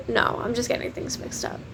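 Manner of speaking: unvoiced self-conscious aside; soft whispered excuse; embarrassed whisper. Real, not acted. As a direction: mutter it to yourself, embarrassed.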